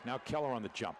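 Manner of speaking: usual speaking voice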